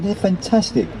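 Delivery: put-on high-pitched young enthusiasm; enthusiastic. A narrator's reading. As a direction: gush a little, pitched well up. Young pigeon enthusing about flyers.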